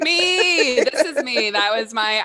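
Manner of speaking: laughing through the words. Real, not acted. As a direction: laugh while saying it. Laughing at the joke.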